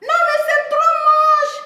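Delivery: high, loud, slow put-on voice; high loud scandalized coworker; mock disgusted colleague. Colleagues mocking her hairy legs.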